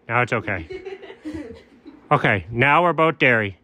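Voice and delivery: ordinary talk, nothing marked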